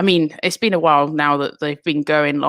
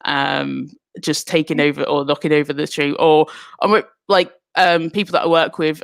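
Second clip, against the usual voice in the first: ordinary delivery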